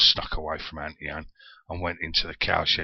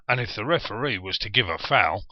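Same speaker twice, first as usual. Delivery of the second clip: normal talking voice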